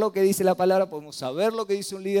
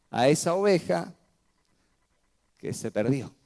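usual speaking voice

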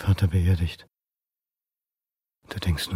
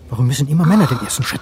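louder, fast burst of frustration; frustrated outburst; 4 semitones higher, 6 dB louder, faster than usual. Acted. Man drinking alone, angry outburst about his failed love. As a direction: blurt it out in anger.

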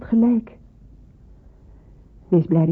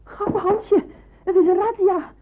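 much higher, breathless alarm; alarmed urgency; 12 semitones higher than usual. Acted. Landlady wakes Hansje to warn of the raid, panicked and high. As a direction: rush him out of bed in panic.

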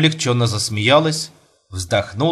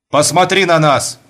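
a sharp command, louder, said at normal speed; sharp loud order; 5 semitones higher, 5 dB louder than usual. Acted. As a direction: bark the order quickly at her. Husband orders wife to look. (резкий громкий приказ)

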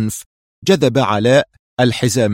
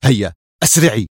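urgent call; 4 dB louder than usual. A narrator's reading. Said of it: "هاتفا".

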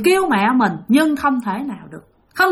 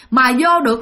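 ordinary delivery, nothing marked

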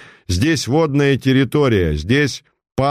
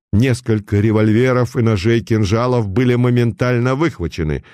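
usual speaking voice

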